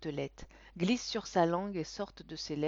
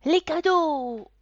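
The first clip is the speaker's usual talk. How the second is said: put-on high, slow, loud child's yell; yelling boy; 10 semitones higher, 13 dB louder, much slower than usual. A narrator's reading. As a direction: scream it like an excited little boy. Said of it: "hurle le petit garçon". (cri d'enfant aigu et fort)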